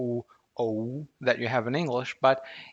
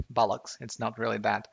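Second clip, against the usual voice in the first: ordinary talk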